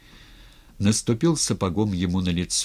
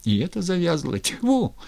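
higher, irritated grumbling; exasperated cursing; 7 semitones higher than usual. A narrator's reading. Cossack cursing his stuck pike, exasperated. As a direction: rise in annoyance to a high spit. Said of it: "ругались".

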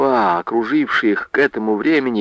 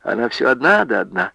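plain reading voice

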